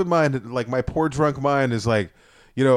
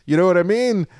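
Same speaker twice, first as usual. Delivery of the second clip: usual speaking voice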